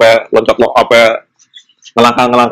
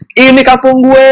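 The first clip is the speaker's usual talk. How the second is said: excited yell; 11 semitones higher than usual. Retold or imitated. Announcing his home village to friends, excited yell. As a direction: shout it out with relief. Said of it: "gua sampai teriak kayak".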